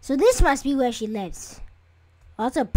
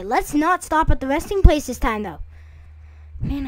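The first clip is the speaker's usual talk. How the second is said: normal talking voice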